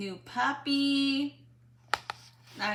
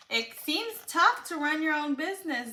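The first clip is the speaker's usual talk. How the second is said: plain reading voice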